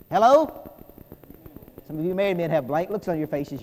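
ordinary delivery

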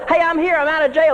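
lightly voiced Peter, high and eager; Peter at the door. Freed Peter knocking at the church door, lightly voiced high.

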